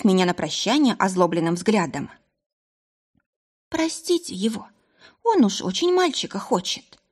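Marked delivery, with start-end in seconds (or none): 3.7-7.0 higher, apologetic pleading; sorry on his behalf. Acted. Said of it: "извиняющимся тоном проговорила женщина".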